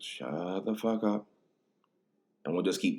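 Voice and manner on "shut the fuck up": slow, held-back yell as if from another room; distant yell. Retold or imitated. Justin heard from the distance while friends talk, muted mock yell. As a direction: imitate a faraway, drawn-out shout. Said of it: "you just hear from the distance".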